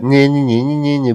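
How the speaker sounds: singsong mock taunting nah nah; mock bully taunt. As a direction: tease like a playground bully. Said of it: "bully talk".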